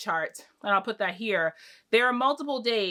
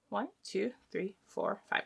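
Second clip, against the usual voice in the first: softly counted under the breath; counting to oneself; 9 dB quieter than usual. Real, not acted. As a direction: count quietly, as if checking for yourself. Counting stitch markers to herself.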